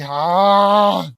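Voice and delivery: loud exasperated groan; a long, loud groan, fed up with the story; frustrated groan. Real, not acted.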